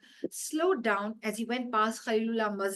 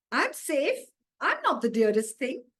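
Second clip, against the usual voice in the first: higher, louder gleeful relief; relieved delight; 4 semitones higher, 5 dB louder than usual. Acted. Goat tells himself he is safe, gleeful. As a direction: goat rejoicing he's spared.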